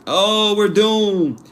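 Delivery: high, slow, whiny mock despair; mocking people who cry doom, high and drawn out; mock doomsayer. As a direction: ham up the panicking pessimist. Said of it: "Like, mock doomsayers".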